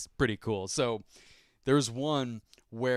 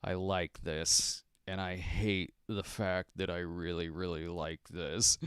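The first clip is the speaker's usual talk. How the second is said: low, slow, softer imitation of reluctant approval; grudging admission; 4 semitones lower, 7 dB quieter than usual. Judge admitting he likes the bacon cake, grudging imitation. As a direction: drag it out quietly, as if hating to admit it. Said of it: "he's just like".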